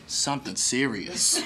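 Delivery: soft, slow preacher landing; mock pastor seriousness. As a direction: drop to a slow, hushed pastor punchline. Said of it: "that hook at the end lands it as a pastor".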